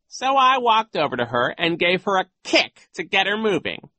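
high, loud storytelling villain; scheming coach making his report; sly villain coach. Acted. Voices Genghis continuing his story.

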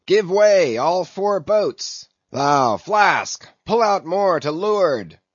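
loud, high captain's shout; shouted orders. A narrator's reading. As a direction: Ahab roaring across the water to his boats. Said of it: "cried Ahab".

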